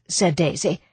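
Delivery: plain reading voice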